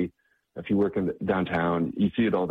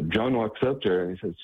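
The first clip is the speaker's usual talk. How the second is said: normal talking voice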